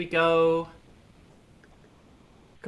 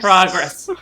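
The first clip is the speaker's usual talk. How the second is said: loud; 3 semitones higher, 13 dB louder than usual. Real, not acted. Repeating the focus word.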